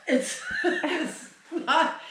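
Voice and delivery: high, laughing through the words. Real, not acted. Horse trainer cracks up at her breath demo, laughing. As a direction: break up laughing mid-sentence, slowly.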